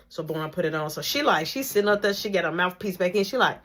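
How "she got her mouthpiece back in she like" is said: ordinary delivery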